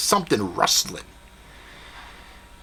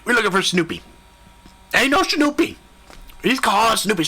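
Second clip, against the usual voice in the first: ordinary talk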